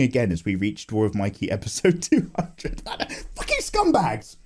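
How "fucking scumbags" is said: mock outrage. Ranting at the company.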